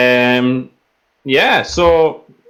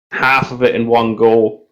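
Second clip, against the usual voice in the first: normal talking voice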